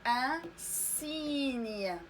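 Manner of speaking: "ان سيني" very slow, with a long si; model pronunciation. Shown as a demonstration. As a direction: pause after "ان", then stretch "سيني" from a clear s. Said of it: "إذا غادي نطقوها". (نطق "ان سيني" ببطء مع مد السين والياء)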